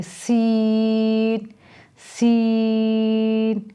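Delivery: सीन with a long hissing, whistly s; whistling seen. Shown as a demonstration. Teacher shows the whistling s of सीन. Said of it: "सीन की सीटी सी की आवाज़ आएगी".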